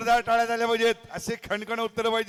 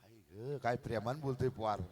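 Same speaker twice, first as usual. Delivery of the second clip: soft aside; 9 semitones lower, 11 dB quieter than usual. Real, not acted. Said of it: "ते माझं होतं".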